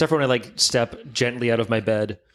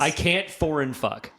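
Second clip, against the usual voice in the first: slow mock fumble; 3 semitones higher, slower than usual. Mocking friend's fumble.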